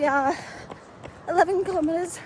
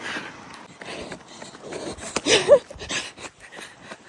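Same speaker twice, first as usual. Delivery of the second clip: windy laugh; 9 semitones higher, 3 dB louder than usual. Real, not acted. Laughing at the wind.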